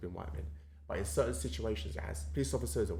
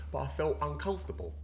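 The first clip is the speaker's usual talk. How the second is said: usual speaking voice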